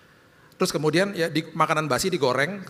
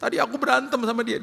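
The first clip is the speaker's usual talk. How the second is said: put-on child voice; 8 semitones higher than usual. Daughter confessing.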